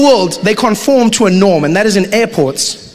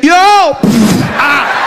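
taxi driver yell; 4 semitones higher, 4 dB louder than usual. Retold or imitated. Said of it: "taxi driver will be like".

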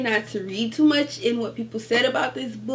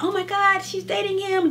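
gossipy gasp; 10 semitones higher than usual. Retold or imitated. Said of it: "They're like".

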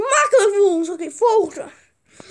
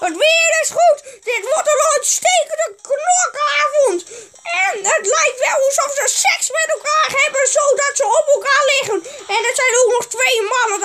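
raised, lively match-announcer patter; mock sports commentator; 3 semitones higher than usual. Gamer commentating the fight night, mock sportscaster voice. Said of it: "dit is uw commentator".

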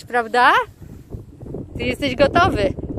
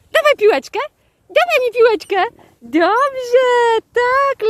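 high, eager coaxing; excited dog talk; 9 semitones higher than usual. Real, not acted. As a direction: coax the dog for the ball in a high, playful voice. Zachęca psa do oddania piłki. (podekscytowane, wysokie zachęcanie psa)

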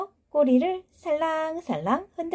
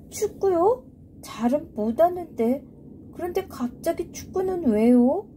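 put-on high, eager little kid; young child voice; 5 semitones higher than usual. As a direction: speak like a curious young child. Child Miyeok puzzled.

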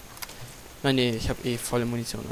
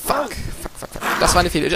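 usual speaking voice

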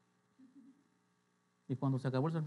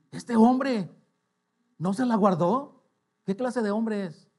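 louder, higher astonished exclamation, imitated; amazed stranger; 6 semitones higher, 9 dB louder, slower than usual. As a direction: exclaim in mock disbelief, drawn out. Stranger's amazement at returned wallet.

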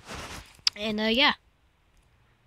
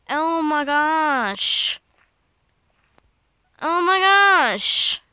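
slow, drawn-out and louder, still frightened; shaken; 4 semitones higher, 7 dB louder, much slower than usual. Real, not acted. Player reeling from a scare, slow frightened exclamations. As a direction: let the fright stretch each word.

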